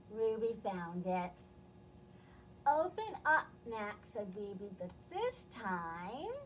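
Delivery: lively storybook reading with voiced characters; reading to children. A narrator's reading. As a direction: read it to little kids, expressive. Reads picture book to children.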